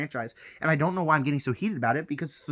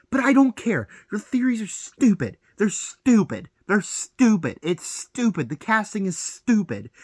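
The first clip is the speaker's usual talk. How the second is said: heated rant; 6 semitones higher, 4 dB louder than usual. Real, not acted. Heated rant about fan theories.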